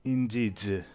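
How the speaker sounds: put-on formal French-accented blessing; courteous, halting French accent; French-accented knight. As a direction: bid farewell as a French knight. GM voices the departing knight.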